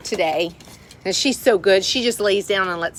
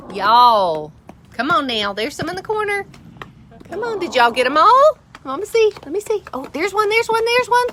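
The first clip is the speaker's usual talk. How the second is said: excited calling; 9 semitones higher, 4 dB louder than usual. Real, not acted.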